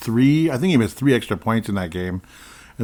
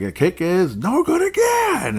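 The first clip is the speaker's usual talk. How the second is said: announcer call; 11 semitones higher, 4 dB louder, slower than usual. Retold or imitated. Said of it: "P A was like".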